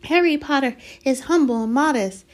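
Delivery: high, loud, reverent elf voice; reverent house-elf. A narrator's reading. Dobby praising Harry's modesty, reverent. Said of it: "said Dobby reverently".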